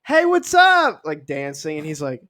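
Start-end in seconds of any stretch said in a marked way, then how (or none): 0.1-1.0 high, loud, bubbly greeting; hammed-up party girl hello; woman greets him in the club, high and bubbly. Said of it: "she's like".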